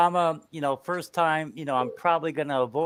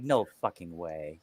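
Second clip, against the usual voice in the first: low, softer, slow disbelief; 9 semitones lower, 7 dB quieter than usual. Retold or imitated. Peeking at the drunk neighbour knocking at night, low and disbelieving. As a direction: mutter it, not believing who's at the door. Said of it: "I'm like".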